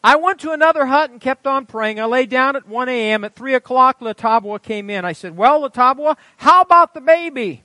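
high, loud pulpit storytelling; full-force preaching narration. A narrator's reading. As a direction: proclaim the story to the whole congregation. Reads testimony at full force.

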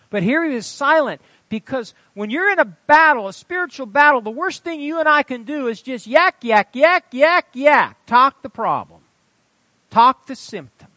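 high, loud, hammering exhortation; full-force preaching. Real, not acted. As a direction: drive the point home to the congregation. Preaches at congregation.

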